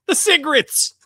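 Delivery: high, loud mock Ricky voice; squeaky mock Ricky pronunciation; Ricky's way of saying it. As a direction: mimic Ricky mangling the word. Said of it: "or as Ricky calls them".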